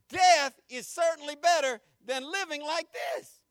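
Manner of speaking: slow shouted quote of despair; preached shout. Acted. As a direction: proclaim it to the back row. Quoting Jonah in sermon.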